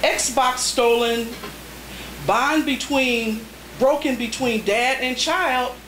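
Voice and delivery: spare, paused haiku reading; deliberate poetry reading; haiku recitation. Acted. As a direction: let each haiku land.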